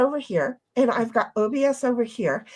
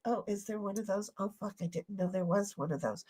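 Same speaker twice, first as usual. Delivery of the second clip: hushed, quick aside; mock cowed staff; 4 semitones lower, 14 dB quieter, faster than usual. Customer care staff reacting to the threat, mock-worried hushed rush. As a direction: imitate a worried underling, soft and fast. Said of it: "And they're like".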